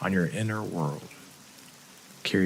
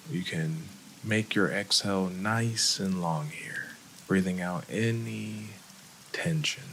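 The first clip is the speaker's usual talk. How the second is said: calm, unhurried meditation voice with long pauses; soothing breathing-exercise guide; guided meditation; 3 semitones lower, 5 dB quieter than usual. Real, not acted. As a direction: ease them through each breath, leaving space after every phrase.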